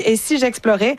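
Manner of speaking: light mock Dutch accent on the question. The tourist, tired of museums, asking herself lightly in a mock Dutch accent. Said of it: "avec l'accent néerlandais".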